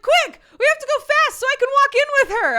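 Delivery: loud, fast, high-pitched urgency; hammed-up kid's excited yell; excited little kid. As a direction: mimic a child urgently hurrying mom along. Imitates child rushing to friend.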